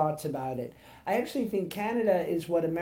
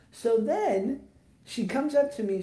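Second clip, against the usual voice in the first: usual speaking voice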